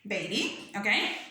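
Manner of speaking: normal talking voice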